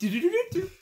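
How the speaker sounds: high staccato cartoon call; high, rapid imitated jingle; Woody Woodpecker. Shown as a demonstration. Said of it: "I sound like Woody Woodpecker".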